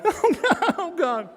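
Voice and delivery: high, squealed pleading; whimper-squeal like a comic coward; mock panic. Boxer cowers under fresh punches, high mock-terrified squeal.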